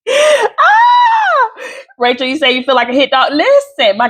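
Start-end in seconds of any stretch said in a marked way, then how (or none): 0.1-1.5 sudden high-pitched yelp of delight; high shout. Real, not acted. Teacher squeals at a listener's comment. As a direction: let out a sharp squeal.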